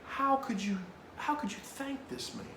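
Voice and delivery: high, strained incredulous plea; stunned disbelief. Retold or imitated. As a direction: question him in mock bewilderment. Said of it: "I'm just dead".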